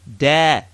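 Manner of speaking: firm "Da" with the tongue tip; teacher modeling "Da"; model syllable. Shown as a demonstration. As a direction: say "Da" clearly for repeating. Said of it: "Say it with me".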